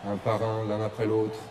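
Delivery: low, hushed commentator voice; whisper-like reverent hush; hushed commentary. Acted.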